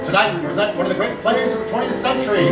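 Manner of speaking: ordinary delivery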